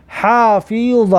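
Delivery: drawn-out model reading of حافظ with pharyngeal ح; throaty ح. Shown as a demonstration. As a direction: slowly sound out حافظ, opening on a throat-made h.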